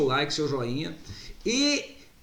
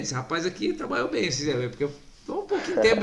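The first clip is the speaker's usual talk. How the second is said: usual speaking voice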